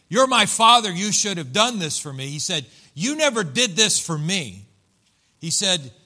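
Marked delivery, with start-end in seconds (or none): 0.1-2.3 loud, high opening that eases off; indignant. Acted. Preacher voicing the older brother's complaint to his father, indignant. 2.9-4.6 raised, loud accusation; resentful older brother. Acted. Older brother complains to his father, resentful. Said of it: "that's the way he said it".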